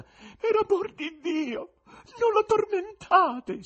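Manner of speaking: high, slow, crying plea; pleading woman. A narrator's reading. Woman in a box begging the magician, crying plea. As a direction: beg for mercy over the noise. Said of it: "voce femminile che coprì il fracasso".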